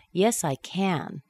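"can" with a full "a" sound; full-vowel can. Shown as a demonstration. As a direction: say CAN long and full at the end. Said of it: "we would make the full".

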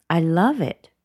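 "I love it" with LOVE punched and falling; strong, true. Shown as a demonstration. Not light and casual about it, strong emphasis. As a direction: hit "love" with conviction. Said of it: "It's strong".

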